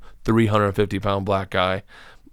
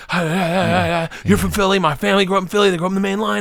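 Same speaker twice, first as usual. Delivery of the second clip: high, frantic nervous babble; mock your own nervous, high-pitched spewing; spewing like a crackhead; 8 semitones higher than usual. Nervous comic spewing to Will to make a connection, mock babble. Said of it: "start spewing like a crackhead".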